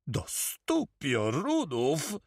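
drawn-out, high-pitched exclamation; exclaimed oath. A narrator's reading. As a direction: cry it out slowly, pitched well up. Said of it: "wykrzyknął Karma".

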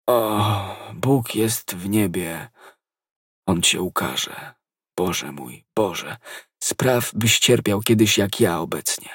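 slow, faint, failing voice; dying prayer. A narrator's reading. Dying soldier calls on God against his poisoner, failing. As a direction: pray for vengeance with the last of your breath.

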